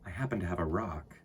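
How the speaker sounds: put-on unhurried read-aloud for kids; storybook reading. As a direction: read it like storytime to little ones. Reads a children's book aloud.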